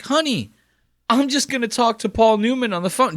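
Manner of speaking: high put-on voice; mock Joel. Mimicking Joel telling his girlfriend about the call, in a high voice. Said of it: "I'm like".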